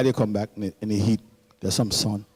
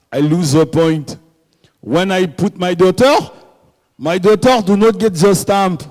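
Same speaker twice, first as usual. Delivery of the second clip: put-on French accent. French father grumbling about his daughter's passport stamp, French accent. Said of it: "I love French accent".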